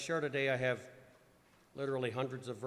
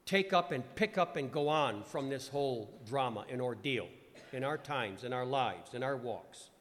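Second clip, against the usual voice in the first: preaching read; 5 dB louder than usual. Acted. Reads and preaches on verses.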